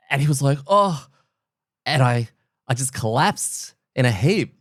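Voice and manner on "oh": high, loud startled oh; shocked gasp. Retold or imitated. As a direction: react in sudden alarm. Friend reacts to collapse.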